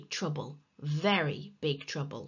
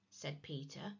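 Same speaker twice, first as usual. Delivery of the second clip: plain reading voice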